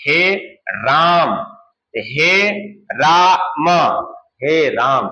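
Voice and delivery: very slow, राम held extra long; prolonged vowel call. Shown as a demonstration. Teacher shows pluta vowel, राम stretched very long. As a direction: draw out हे राम as if calling far. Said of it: "तीन मात्राओं का समय लग रहा है".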